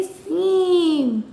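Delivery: सीम drawn out very slowly; stretched word. Shown as a demonstration. Teacher modelling सीम for pupils, very slow. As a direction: hold the ई in सीम long. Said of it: "क्या पढ़ेंगे?".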